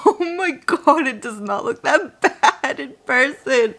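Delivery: real laugh at her own face on camera; laughing in disbelief at her makeup. Real, not acted.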